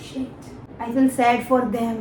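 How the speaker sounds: usual speaking voice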